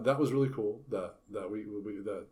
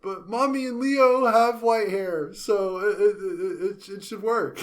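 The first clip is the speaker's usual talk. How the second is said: put-on much higher, slow, childlike pleading; little girl Lucy; 9 semitones higher, 6 dB louder, slower than usual. Lucy explaining why she used the comb, high and childlike. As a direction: explain it like an earnest small kid. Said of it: "and then her reasoning was".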